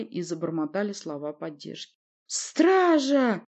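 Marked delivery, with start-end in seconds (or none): 2.3-3.4 high, loud shout for the guards; crying out. A narrator's reading. Prince calls guards to his rescue, crying out. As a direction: call out urgently across the hall. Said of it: "вскричал князь".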